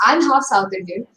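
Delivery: usual speaking voice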